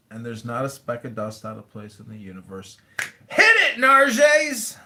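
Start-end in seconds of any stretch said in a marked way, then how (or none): 3.3-4.8 high, loud showman's cue; rousing shout. Real, not acted. Host calling on the performer to start, rousing shout.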